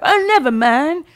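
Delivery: high silly voice; weird character voice. Retold or imitated. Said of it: "Who is that voice?".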